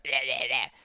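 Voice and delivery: hammed-up choking gag, repeated; mock choking noises. Woman being choked by the mist, imitated choking. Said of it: "she starts getting choked".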